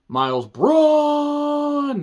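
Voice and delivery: loud, high, hugely drawn-out surname; hammed-up announcing. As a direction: bellow the name and hold the last vowel. Hammed-up announcing of character's name.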